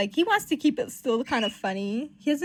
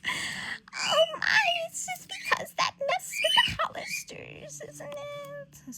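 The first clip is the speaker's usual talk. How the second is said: put-on very high, quavering old-lady fretting; hammed-up fragile aunt, fading at the end; Aunt May; slower than usual. Aunt fretting about the Hollisters, quavery high voice. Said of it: "This is Aunt May bullshit".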